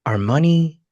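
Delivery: ordinary talk, nothing marked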